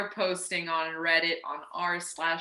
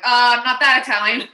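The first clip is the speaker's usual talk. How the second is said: loud mock reply; 6 semitones higher, 17 dB louder, faster than usual. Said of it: "you just kinda were like".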